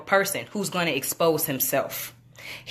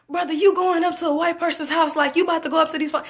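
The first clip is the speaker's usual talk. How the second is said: higher, urgent, speeding up at the end; warning friend; 9 semitones higher, faster than usual. Retold or imitated. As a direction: warn him off, faster and faster. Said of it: "the partner was like".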